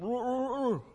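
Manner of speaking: put-on boy mumbling again with worms held in mouth; mumbled through a full mouth. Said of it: "he just sort of mumbled at him".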